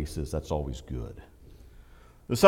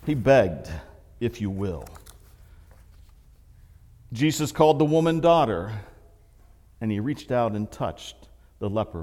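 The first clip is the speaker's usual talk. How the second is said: ordinary talk, nothing marked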